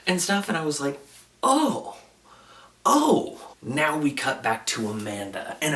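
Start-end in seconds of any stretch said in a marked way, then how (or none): 1.4-3.4 high, startled oh, twice; retold shocked reaction. Host reliving the moment her head starts bleeding, shocked high ohs. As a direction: let each oh jump up sharply in pitch.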